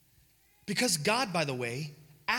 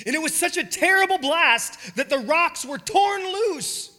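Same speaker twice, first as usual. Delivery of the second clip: much higher and louder, booming out the wind and fire; thundering storm telling; 7 semitones higher, 10 dB louder than usual. Acted. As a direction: let the storm roar through your voice. Dramatic retelling of the storm.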